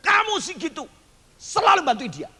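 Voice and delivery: high, nagging reproach; scolding parent. Retold or imitated. Parent scolds the boy for always losing, high. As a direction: nag the kid from above.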